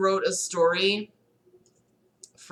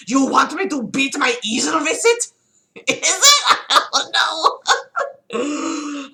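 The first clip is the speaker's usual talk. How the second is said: high, loud, cracking into laughter; laughing disbelief; 8 semitones higher, 6 dB louder, slower than usual. Acted. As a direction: squeal it in laughing shock. Adolf aghast, laughing.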